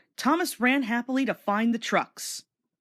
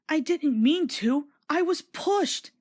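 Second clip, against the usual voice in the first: higher, slow small voice; sadly; 7 semitones higher, slower than usual. A narrator's reading. Thomas explaining to the fat director, sad and small. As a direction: say it sorrowfully. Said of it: "said Thomas sadly".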